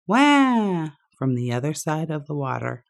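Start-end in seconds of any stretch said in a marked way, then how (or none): 0.1-0.9 high loud wail from afar; echoed boo-hoo. A narrator's reading. Unseen duck wailing back across the water. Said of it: "and he heard".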